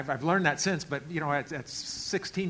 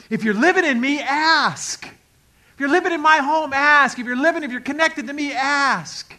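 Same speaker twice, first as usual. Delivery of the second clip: loud, high, emphatic urging; ringing pulpit exhortation; impassioned preaching; 9 semitones higher, 11 dB louder than usual. Acted. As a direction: proclaim it at full force, landing hard on each "ask". Preacher voices God's urging.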